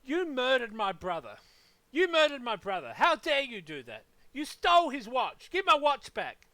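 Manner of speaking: hammed-up outrage at a random man; mock furious accusation. Accusing a stranger in the street, mock furious yell. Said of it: "walking down the street and saying".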